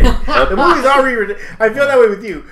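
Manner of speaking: high, loud, incredulous outburst; mock exasperated rant. Moviegoer griping about a kiss scene, mock exasperated. Acting out outrage at film kiss.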